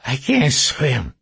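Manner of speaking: slow, slurred through broken teeth; slurred speech. Acted. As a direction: slur the words, mouth damaged. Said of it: "He slurred his words".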